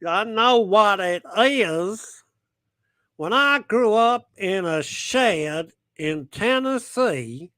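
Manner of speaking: exaggerated southern US accent, slow and high; put-on southern accent. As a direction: drag it out like a hammed-up Tennessee native. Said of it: "with a southern accent".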